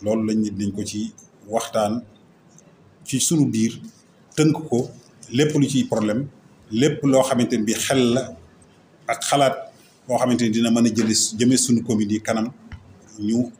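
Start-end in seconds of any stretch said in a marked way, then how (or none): none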